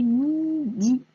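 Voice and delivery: "midi" slow, each i held long; syllables blended. Shown as a demonstration. Teacher assembling the sounds for a pupil, slow syllables. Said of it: "on a le de et le i".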